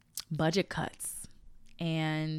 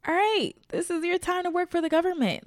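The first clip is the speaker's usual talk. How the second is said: higher, louder pep talk to a friend; hyped encouragement; 12 semitones higher, 5 dB louder than usual. Retold or imitated. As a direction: fire her up to go for it. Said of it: "And I'm like".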